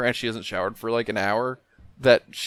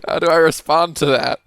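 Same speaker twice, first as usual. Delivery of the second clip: baffled; 4 semitones higher, 7 dB louder than usual. Retold or imitated. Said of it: "was like".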